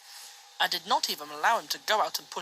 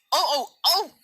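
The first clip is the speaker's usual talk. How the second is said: higher, louder mocking trucks' groan; trucks groaning; 9 semitones higher, 8 dB louder than usual. A narrator's reading. The trucks complaining at James, higher and louder. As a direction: moan it up high like cheeky trucks. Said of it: "said the trucks".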